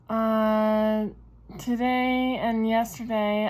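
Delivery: ordinary talk, nothing marked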